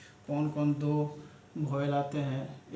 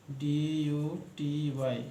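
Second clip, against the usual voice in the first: slow spelling; much slower than usual. Shown as a demonstration. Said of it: "teacher spells word slowly".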